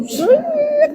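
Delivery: thin high "sreet" sound effect; imitated twisting pinch. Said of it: "tiga ratus enam puluh derajat puterannya".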